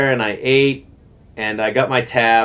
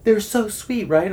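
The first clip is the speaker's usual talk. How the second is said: much higher, much softer gush; gushing waitress; 10 semitones higher, 4 dB quieter than usual. Retold or imitated. Waitress hearing about the paid tab, a high soft gush. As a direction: coo it like a touched waitress. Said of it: "And she's like".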